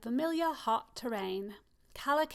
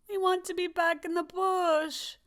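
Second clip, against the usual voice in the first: higher, louder cry of longing; exclaiming; 6 semitones higher, 5 dB louder than usual. Acted. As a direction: call out wanting to go home.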